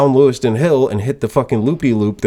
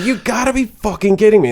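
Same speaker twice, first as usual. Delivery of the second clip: high-pitched retold exasperation; pissed-off disbelief; 11 semitones higher than usual. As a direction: replay incredulous irritation, pitched way up. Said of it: "it was getting pissed".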